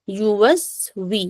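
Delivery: युवस्वी slowly, "yu" then a long "wee"; thin kasra. Shown as a demonstration. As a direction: draw out युवस्वी on pure "oo" and "ee". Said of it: "कसरा भी बारीक करेंगे". (युवस्वी, धीरे, बारीक ई)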